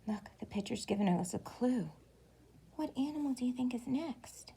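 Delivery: secretive storytime whisper. Real, not acted. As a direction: lean in and whisper the question. Whispers question to children.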